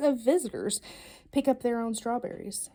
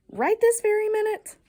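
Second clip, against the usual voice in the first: high, astonished rising question; amazed question; 10 semitones higher, 5 dB louder than usual. Acted. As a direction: playfully act amazed. Incredulous echo of child's wish.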